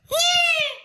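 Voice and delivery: very high shrieking squawk; pterodactyl screech. Pretend. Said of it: "that was my pterodactyl".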